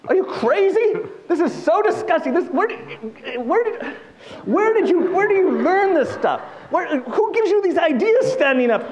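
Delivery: high, loud outraged scolding; hammed-up indignant tirade; scandalised rabbi. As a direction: berate the young man in utter disbelief.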